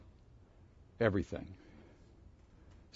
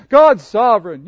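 glib; 6 semitones higher, 12 dB louder than usual. Retold or imitated. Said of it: "it's easy to say".

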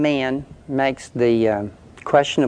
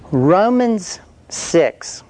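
very slow, weighty Romans six; emphatic; 5 semitones higher, much slower than usual. Acted. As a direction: land the words slowly for emphasis. Preacher drives home the point.

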